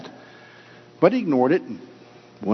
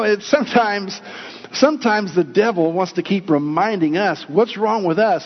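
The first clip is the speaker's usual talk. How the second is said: ordinary delivery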